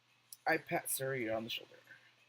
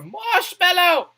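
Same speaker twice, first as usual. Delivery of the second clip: squeal; 17 dB louder, slower than usual. Real, not acted. Squealing word.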